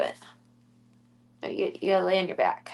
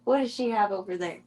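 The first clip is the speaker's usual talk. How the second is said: usual speaking voice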